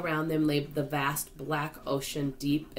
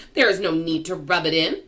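louder, offended retort; snapping; 7 dB louder than usual. A narrator's reading. Blind earthworm tells James off, snapping. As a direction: scold him testily. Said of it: "snapped the earthworm".

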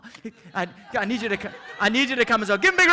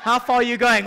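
ordinary talk

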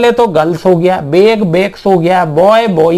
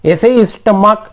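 usual speaking voice

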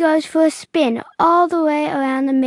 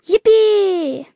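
excited cheer; 5 semitones higher, 3 dB louder than usual. A narrator's reading. Jack sliding down the slide.

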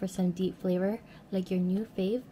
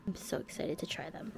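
whispering; 3 semitones lower, 9 dB quieter than usual. Real, not acted. Whispered aside.

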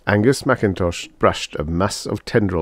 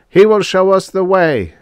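high, loud exalted proclamation; robot's shout; 12 semitones higher, 8 dB louder than usual. A narrator's reading. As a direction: shout it like a zealous believer. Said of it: "shouted R A two oh four".

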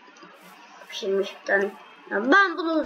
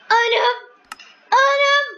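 panicked exclaiming; 12 semitones higher, 4 dB louder than usual. Real, not acted.

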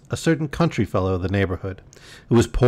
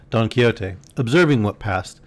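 ordinary delivery, nothing marked

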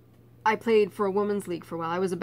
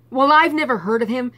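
higher and much louder, slowing at the end; indignant huff; 6 semitones higher, 11 dB louder than usual. Retold or imitated. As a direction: scoff like a cocky teen who lost. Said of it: "I was still so mad".

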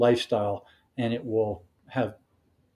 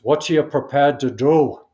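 put-on a light Scottish accent, a bit louder; lightly imitating a Scottish accent, firmly asked; Sean Connery Scottish accent; 7 dB louder than usual. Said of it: "in a Scottish accent".

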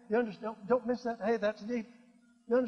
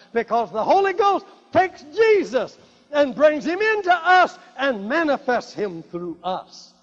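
loud, raised, emphatic scripture proclamation; full-force preaching; 8 dB louder than usual. Acted. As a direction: proclaim the verse to the whole congregation.

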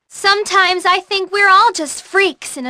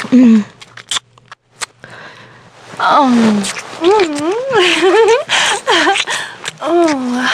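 contented kissing hums; much slower than usual. Acted. Kissing scene voice lines.

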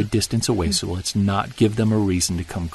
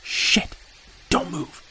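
hissed curse, then higher whispered order; sharp whispered alarm; 8 semitones higher, slower than usual. Acted. Hunter whispering to his friend as rocks fly. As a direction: freeze him with a sudden whisper. Rock thrown at their camp.

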